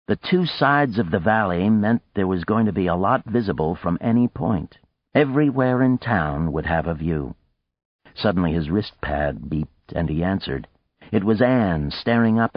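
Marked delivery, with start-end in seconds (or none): none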